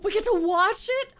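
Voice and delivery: gasp, then a high, loud thrilled question; thrilled disbelief; delighted gasp. Real, not acted. Delighted at the cutscene.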